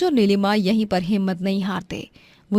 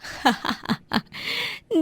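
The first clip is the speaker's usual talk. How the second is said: hushed wicked giggle; 2 semitones higher, 6 dB quieter than usual. Acted. Villain wife laughs quietly.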